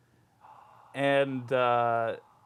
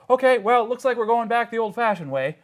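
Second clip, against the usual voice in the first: higher, louder character voice; Sir Archibald; 6 semitones higher, 4 dB louder than usual. Acted. GM as a knight resorting to old methods, raised voice. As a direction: announce it briskly in a raised voice. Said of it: "Sir Archibald goes".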